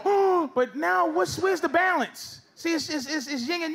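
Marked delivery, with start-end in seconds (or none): 0.0-0.5 high-pitched acted "oh"; mock shocked gasp. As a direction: gasp as if scandalized. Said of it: "and have you go".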